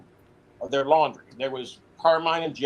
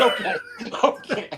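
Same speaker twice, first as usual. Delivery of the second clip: breathy laughing higher okay; 9 semitones higher than usual. Retold or imitated. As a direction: laugh it off in disbelief. Retelling his reply, laughing.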